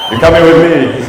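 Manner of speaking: loud, forward come-on line; bold woman. Retold or imitated. Comic voicing the Australian girl, loud and forward. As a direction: say it loud like she's claiming him. Girl's reply in story, loud.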